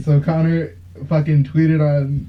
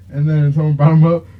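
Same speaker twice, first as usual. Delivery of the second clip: usual speaking voice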